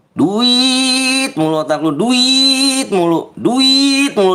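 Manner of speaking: high, slow nagging mock voice; mocking whiny kid. Streamer mocking a greedy kid in chat, high whiny taunt. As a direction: drag it out like a whiny brat.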